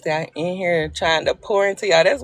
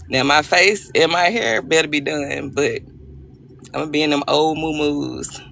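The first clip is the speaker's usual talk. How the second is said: normal talking voice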